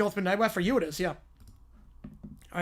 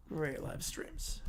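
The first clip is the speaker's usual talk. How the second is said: slow breathy whisper; 7 semitones lower, 15 dB quieter, much slower than usual. Shown as a demonstration. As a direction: breathe it out slowly, almost no voice. Repeats his shout-out, then whispers it.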